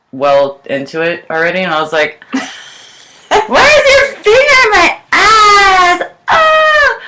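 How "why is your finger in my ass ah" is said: very high, drawn-out mock scream; shocked shriek. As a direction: re-enact a startled, piercing yelp.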